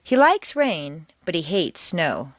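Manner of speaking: "likes" and "hates" both hit, set against each other; contrast stress. Shown as a demonstration. Teacher shows likes against hates in contrast stress. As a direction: punch the two opposing verbs.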